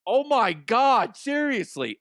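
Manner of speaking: drawn-out fed-up groan at her; exasperated. Retold or imitated. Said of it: "I was like".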